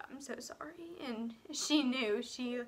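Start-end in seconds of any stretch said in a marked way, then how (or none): 0.1-0.9 barely voiced, breathy whisper; a small broken whisper of sorry; whispered apology. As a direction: whisper it as if choked up. Confessing pregnancy to her mother.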